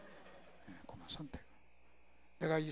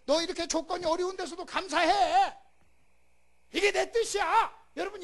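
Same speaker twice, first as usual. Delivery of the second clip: high, loud fervent preaching; preaching at full force; 12 semitones higher, 16 dB louder than usual. Acted. Preacher proclaiming God's words to the congregation, fervent. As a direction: thunder out God's promise.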